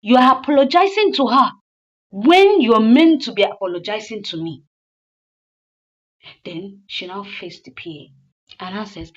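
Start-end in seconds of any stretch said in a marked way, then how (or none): 0.0-4.6 louder, a touch higher reproach; indignant wife. Retold or imitated. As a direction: throw the apology back at him. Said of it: "the wife was like".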